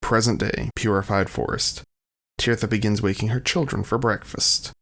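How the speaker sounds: normal talking voice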